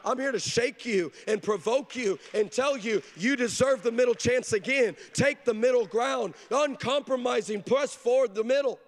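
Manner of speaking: rising preacher's call; preaching build. Acted. Preacher builds to full force.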